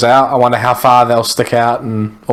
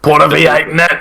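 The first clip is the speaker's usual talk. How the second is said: put-on playful, higher-pitched American accent; mock American twang; attempted American accent; 4 semitones higher than usual. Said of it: "was that your American".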